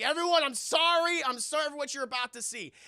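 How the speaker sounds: loud, high hammed-up announcement; desperate shouted apology. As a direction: call out to the whole room in mock desperation. Retold desperate announcement to diner.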